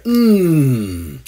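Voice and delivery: low knowing hum; mock thoughtful hum. Mocking a host humming at a guest, low hum. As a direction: hum as if deeply moved.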